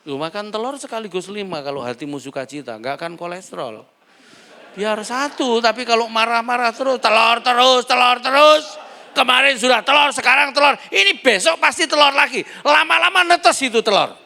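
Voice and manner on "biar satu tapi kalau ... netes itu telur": loud, high, ranting complaint; mock angry husband. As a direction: rant about eggs again and again. Angry husband complaining about eggs. (suami marah-marah soal telur, keras dan tinggi)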